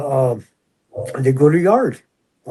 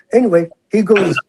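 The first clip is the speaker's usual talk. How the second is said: normal talking voice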